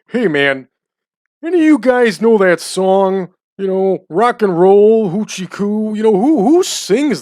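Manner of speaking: loud, high-pitched drunk guy imitation; drunk bar regular. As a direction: imitate a drunk pestering people about a song. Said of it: "all gassed up drunk".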